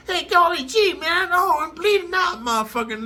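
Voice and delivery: very high, loud, hammed-up wail; mock panic. Mocking how a stabbed man would react, high hammed-up panic. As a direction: squeal like a sham helpless victim.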